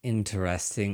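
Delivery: ordinary talk, nothing marked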